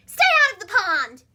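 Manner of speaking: loud frog yell across the pond. Acted. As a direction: bellow it as the bossy frog Milton. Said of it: "yelled Milton".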